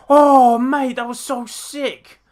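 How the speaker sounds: high, loud and slow, stretching out mate; ecstatic yell. Real, not acted. Fan yelling at the screen over a huge move, thrilled. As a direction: bellow it out in pure awe. Reacting to a wrestling spot.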